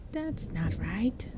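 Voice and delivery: slow withering goblin put-down; the first goblin scorning Sarah's spell. Acted. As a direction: sneer it slowly. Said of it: "said witheringly".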